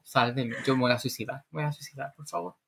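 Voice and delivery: soft, defeated pleading, fading away; mock despair. Cook finding the kitchen dirty again, fading mock despair.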